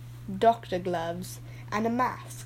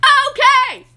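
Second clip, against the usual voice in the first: shout; 13 dB louder than usual. Acted. Shouted agreement.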